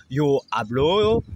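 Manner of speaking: slow, clear first person form; slow conjugation model. Shown as a demonstration. Teacher starts the conjugation table: slow. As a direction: spell out the verb slowly for learners.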